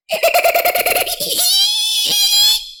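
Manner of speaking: loud high cackling laugh; wild shrieking monster laugh; booming creature laugh. A narrator's reading. Dolphin creature laughs loudly.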